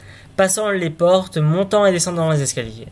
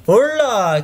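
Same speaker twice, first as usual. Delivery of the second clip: loud startled call, pitched higher; cry out; 6 semitones higher, 4 dB louder than usual. Acted. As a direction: yell it at the thing falling from the chimney. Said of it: "cria-t-il". (s'écrier fort, plus aigu)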